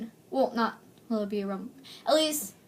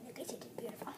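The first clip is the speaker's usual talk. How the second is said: whisper; 20 dB quieter, much faster than usual. Real, not acted. Aside to something nearby.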